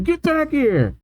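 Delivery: high-pitched shout at held-back volume; angry mock shout. A narrator's reading. Crew yelling after runaway Luffy, high mock shout. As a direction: yell it high without real loudness. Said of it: "angry Nami, Usopp, and Sanji shouting".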